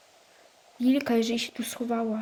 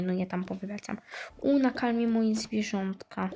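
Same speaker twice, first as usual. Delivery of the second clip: ordinary talk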